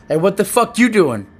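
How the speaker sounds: loud, high, aggressive challenge; confrontational demo. Shown as a demonstration. Showing Ella how tone changes a message, confrontational and loud. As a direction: snap it as an angry accusation. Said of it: "Like when I say ... See the difference?".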